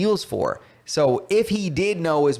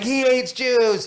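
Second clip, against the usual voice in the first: mock outraged; 11 semitones higher, much slower than usual. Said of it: "They were like".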